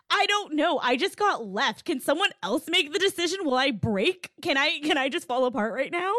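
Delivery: high, pleading, half-laughing; mock overwhelmed bride. Podcaster voicing the jilted bride begging to break down, high and pleading. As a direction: plead as if about to fall apart.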